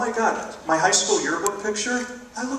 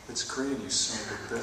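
low, much softer grumble under the breath; muttered curse; 5 semitones lower, 12 dB quieter than usual. Retold or imitated. Driver to the car ahead, muttered curse. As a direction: seethe quietly at the driver.

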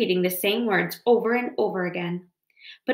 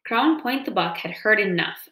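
plain reading voice

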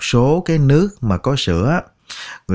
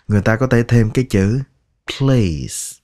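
normal talking voice

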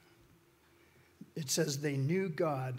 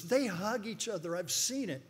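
high, insistent imitation of an upset woman; mock tearful protest; 8 semitones higher, 4 dB louder than usual. Tearful protester defends the prairie dogs to exterminator, mock tearful. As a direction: plead the animals' case in a raised mock-upset voice. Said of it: "just crying tears and just going off".

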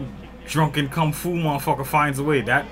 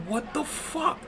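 ordinary delivery

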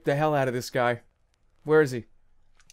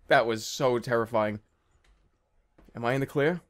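ordinary delivery

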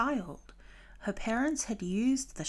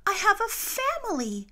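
high, loud call back to her friends; Dory calling back high and loud to Marlin and Nemo; calling back excited; 12 semitones higher, 5 dB louder than usual. A narrator's reading. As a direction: call out eagerly, pitched way up. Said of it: "Dory called back to them".

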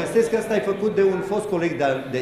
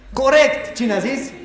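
excited; 9 semitones higher, 6 dB louder than usual. Real, not acted. Quiz answer from audience.

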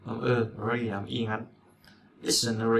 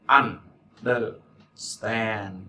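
understand drawn out very slowly, syllable by syllable; slow model word; much slower than usual. Shown as a demonstration. Teacher modeling understand for students, very slow and drawn out. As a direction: stretch understand out slowly so students catch every sound. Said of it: "Trọng âm mà nó, trọng âm stand".

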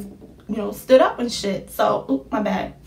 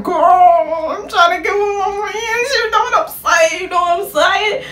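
high, loud, whiny pleading; mock-crying protest to mom; exasperated inner plea; 9 semitones higher, 5 dB louder than usual. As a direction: beg like you're about to cry.

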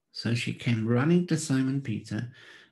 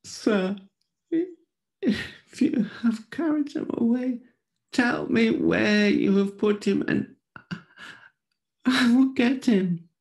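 high, loud, urgent woman's plea; pleading Mary; 11 semitones higher, 5 dB louder than usual. A narrator's reading. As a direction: beg the gardener as a frantic Mary.

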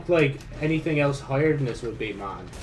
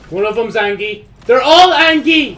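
building from louder to much higher, much louder alarm; rising panic; 8 semitones higher, 11 dB louder than usual. Real, not acted. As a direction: escalate into a panicked shout. Panic building at more enemies.

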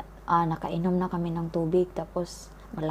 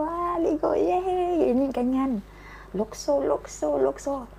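put-on much higher, squealing child's voice, excited to swim; gleeful kid cousin; 9 semitones higher than usual. Boy cousin calling out while jumping in, high gleeful squeal.

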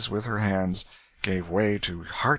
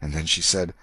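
plain reading voice

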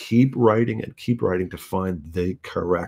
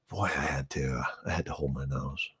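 wry aside; 7 semitones lower, 12 dB quieter than usual. Real, not acted. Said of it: "wry aside about his father".

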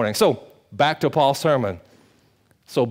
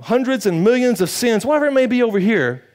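raised, fervent preaching voice; 9 semitones higher, 4 dB louder than usual. Acted. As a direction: preach it out with conviction. Preaching at full force.

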